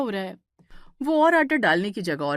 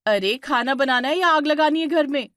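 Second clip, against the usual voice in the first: louder, higher exasperated rebuke; angry scolding; 5 semitones higher, 5 dB louder than usual. Acted. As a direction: scold her for nearly setting fire. Divya scolds after kerosene.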